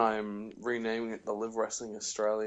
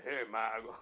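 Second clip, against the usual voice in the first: JBL impression; 4 dB quieter than usual. Shown as a demonstration. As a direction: keep the impression down at natural pitch. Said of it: "Yes, that one".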